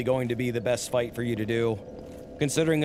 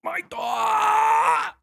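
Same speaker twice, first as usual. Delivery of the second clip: much higher, loud mock wail; Big Smoke yelling again; 4 dB louder, much slower than usual. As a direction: screech it in full cartoon anguish. Repeats the Big Smoke cry.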